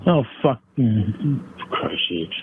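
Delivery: ordinary talk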